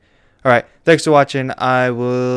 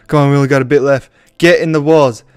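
fast, louder urging shout; 4 semitones higher, 11 dB louder, faster than usual. Real, not acted. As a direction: push him on, yelling. Urging the pig urgently.

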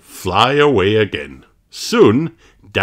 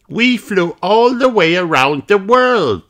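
put-on higher child voice; higher excited little girl; child character voice; 11 semitones higher, 3 dB louder than usual. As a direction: voice it proudly as Peppa. Peppa then Rabbit voiced.

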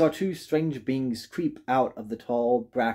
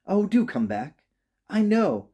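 pleading call, pitched up; calling after someone; 9 semitones higher than usual. A narrator's reading. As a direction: beg them to return in a higher voice. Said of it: "called the little rabbit".

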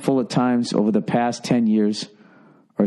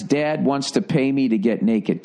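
ordinary delivery

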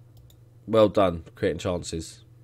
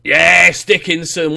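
1 excited yes; 2 semitones higher, 7 dB louder, slower than usual. Real, not acted. Team scores, celebrating.

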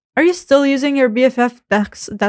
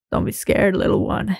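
creepy whisper; 2 semitones lower, 4 dB quieter than usual. Acted. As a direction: breathe it like a predator. Creepy stranger reassuring victim.

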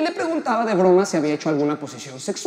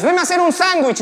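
higher, louder burst on the order; mock bossy order; 8 semitones higher, 8 dB louder than usual. Comedian quoting the joke's punchline, mock bossy order. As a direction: bark it like a crude command.

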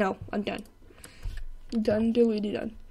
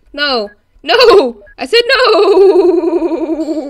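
loud, escalating no, last one stretched out; screamed refusal; 6 semitones higher, 14 dB louder, much slower than usual. Pretend. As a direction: shout no, then hold it very long. Screaming as the game goes wrong.

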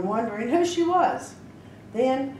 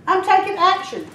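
high, loud, resolute; determined; 9 semitones higher, 6 dB louder than usual. A narrator's reading. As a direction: declare it with fierce resolve. Heroine vows to go.

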